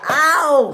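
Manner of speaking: high, loud "ow"; yelp of pain. Acted. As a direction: cry out as if hit. Bert gets fallen on.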